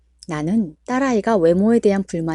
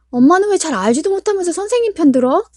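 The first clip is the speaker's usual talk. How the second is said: higher, loud indignant yell; angry child; 10 semitones higher, 6 dB louder than usual. Acted. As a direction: accuse mom at the top of your voice. Child shouting back at mother.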